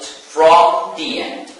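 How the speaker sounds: "from the end" slow, with "from" drawn out; emphatic repeat. Shown as a demonstration. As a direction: drive home "from the end" at a slow pace. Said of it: "I repeat".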